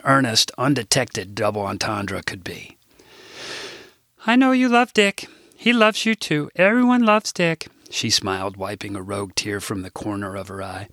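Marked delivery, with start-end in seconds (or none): 4.3-7.7 put-on high, eager older Midwestern lady; chirpy assistant butting in with news; higher sweet Midwestern grandmother voice; Rose's voice. Rose teary about her brother.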